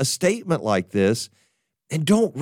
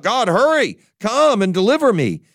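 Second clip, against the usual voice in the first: urgent personal prayer; 8 semitones higher, 3 dB louder than usual. Pretend. Mocks a self-centred urgent prayer.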